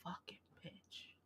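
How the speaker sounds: whispered curse, slow and hushed; under breath. Real, not acted. Cursing the phone assistant under her breath. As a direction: hiss it so nobody hears.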